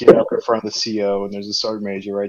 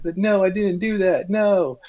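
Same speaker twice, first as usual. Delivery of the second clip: higher pitched pleading denial; mock protest; 10 semitones higher than usual. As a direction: protest your innocence, voice up high. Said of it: "I'm like".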